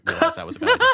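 breaking into laughter mid-sentence; laughing. Real, not acted. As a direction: laugh through the words. Laughs at own joke.

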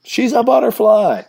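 high-pitched mock sideline cry; mock parent cheer. Parent cheering while the kids are playing, mock high cry. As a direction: pitch it way up, hammed-up.

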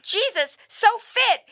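high, gushing text read aloud; exclaiming. Retold or imitated. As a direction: read it out with a big exclamation point. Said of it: "with an exclamation point".

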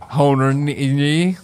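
put-on Horny as "hoh-nee" in an Eastern European accent; imitating an Eastern European accent on Horny. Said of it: "she wasn't saying horny".

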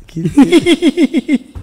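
high, loud words broken by laughter; cracking up mid-question; laughing question. Real, not acted. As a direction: start asking, collapse into laughter. Breaking up while asking.

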